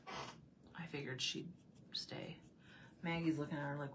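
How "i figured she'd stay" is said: soft whisper. Real, not acted. Aside to viewers about the dog, whispered. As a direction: whisper so the dog won't hear.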